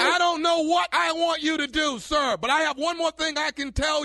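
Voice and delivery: high, loud exasperated shouting. Acted. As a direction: shout it back at him. Prank caller shouting.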